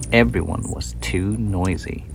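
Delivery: plain reading voice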